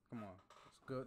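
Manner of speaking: ordinary delivery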